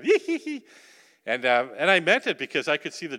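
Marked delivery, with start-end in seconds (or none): 0.0-0.7 high, louder shy giggle, hee hee hee; imitated giggling. Preacher imitates embarrassed girls giggling high again. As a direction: squeak out a bashful giggle. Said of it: "they get embarrassed".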